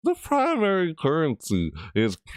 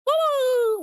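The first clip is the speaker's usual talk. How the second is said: wild whoops. Real, not acted. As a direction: whoop and shriek with glee. Another whoop.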